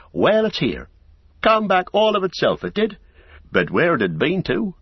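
high, swooping garrulous landlord; flustered, rambling innkeeper. A narrator's reading. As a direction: ramble excitedly with big pitch swings. Innkeeper figure rambles in the story.

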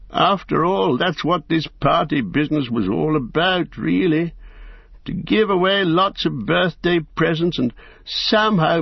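half-laughing at himself; rueful old hobbit. Acted. Bilbo voiced by narrator.

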